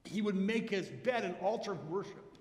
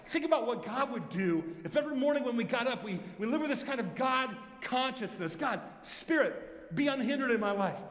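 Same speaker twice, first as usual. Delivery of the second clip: fervent preaching; 4 semitones higher than usual. Acted. Pastor urging his church to live with God consciousness, fervent preaching. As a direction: drive it home with rising fervour.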